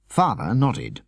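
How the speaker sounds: plain reading voice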